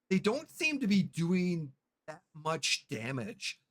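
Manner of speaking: normal talking voice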